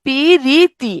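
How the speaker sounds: পিরিতি drawn out syllable by syllable; a long i between প and র in পিরিতি; inserted i sound. Shown as a demonstration. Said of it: "মাঝখানে একটা ই ধ্বনি যুক্ত".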